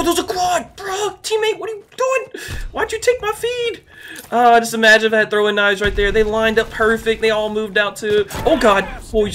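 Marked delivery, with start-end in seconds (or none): 0.0-3.8 very high-pitched, incredulous complaint; exasperated protest. Real, not acted. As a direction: whine in disbelief at a teammate. Protesting to teammate who stole kills.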